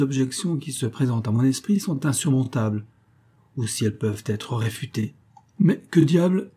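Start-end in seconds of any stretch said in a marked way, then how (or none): 5.6-6.5 a sharp, high burst of surprise at the door; startled exclamation. A narrator's reading. Detective startled by the intruder, higher and louder exclamation. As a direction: blurt it out, caught off guard. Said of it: "Cette exclamation avait été arrachée".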